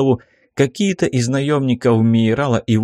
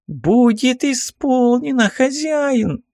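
very high, slow minion voice; high-pitched obedient lackey; servile minion; 13 semitones higher, slower than usual. Acted. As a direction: sing out obedience to your master in a high squeak. Servant accepts master's order.

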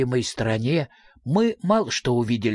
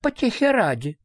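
put-on little girl's voice; 7 semitones higher than usual. A narrator's reading. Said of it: "ответила дочь Мимлы".

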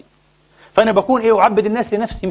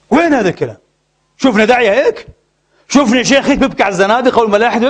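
higher, louder indignant questioning; incredulous challenge; 4 semitones higher, 4 dB louder than usual. Real, not acted. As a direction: throw the questions out in disbelief. Repeats question, raised, in disbelief. (استنكار وتعجب بصوت مرتفع)

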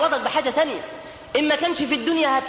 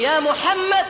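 casual calling out; 8 semitones higher, much slower than usual. Retold or imitated. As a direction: call him over like anyone. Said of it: "ينادي على سيدنا محمد بالطريقة دي".

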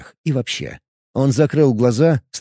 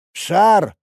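shouting priest; 6 semitones higher than usual. Acted. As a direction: bark the order down at a cornered enemy. Dark priest replies.

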